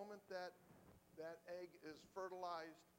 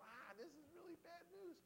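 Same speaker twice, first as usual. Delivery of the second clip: high, softer squeal of discomfort; half-voiced wince, pitched way up; mock pained yelp; 12 semitones higher, 4 dB quieter than usual. Beachgoer on scorching sand, mock pained squeal. Acts walking on burning hot sand.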